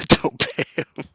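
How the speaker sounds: slow words broken up by laughter; amused, laughing as you repeat it. Real, not acted. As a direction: barely get it out through real laughter. Laughs reading a chat suggestion.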